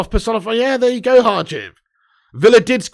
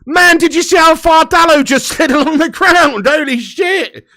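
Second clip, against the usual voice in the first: high, loud, with a laugh in it; can't believe how far he slid; amazed disbelief; 7 semitones higher, 5 dB louder than usual. Real, not acted. Fan reacting to a teammate sliding along the ground, amazed.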